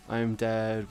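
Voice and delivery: ordinary delivery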